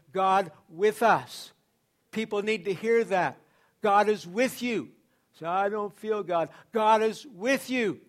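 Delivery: loud, rising sermon climax hammering God is with you; full-force preaching. Acted.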